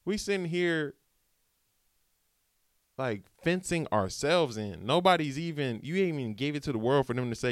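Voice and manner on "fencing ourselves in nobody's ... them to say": usual speaking voice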